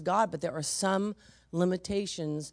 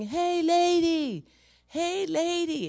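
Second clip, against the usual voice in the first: high, slow calling voice; drawn-out high hey lady; imitating a man calling out at her; mock yell; 11 semitones higher, 5 dB louder, slower than usual. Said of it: "he's screaming at me".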